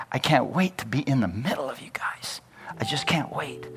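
softly whispered longing; hushed, eager whisper. Retold or imitated. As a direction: breathe it out, can't wait to be near them. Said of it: "It's almost like God saying".

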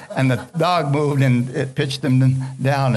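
ordinary talk, nothing marked

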